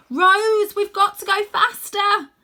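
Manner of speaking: high, loud desperate plea; urgent shout. Acted. Boy urges Rose to hurry.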